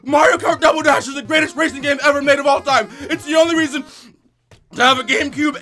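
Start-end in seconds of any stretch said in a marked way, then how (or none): 0.0-2.9 yelling with manic energy; excited shouting. Real, not acted. Hyped, overcoming the heat.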